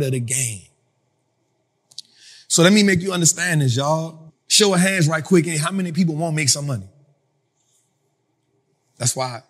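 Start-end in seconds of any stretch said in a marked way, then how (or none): none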